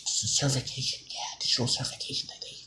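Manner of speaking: imitating Bill Gates in a creepy whisper. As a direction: breathe it out like a sinister insider. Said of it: "And his creepy fucking voice".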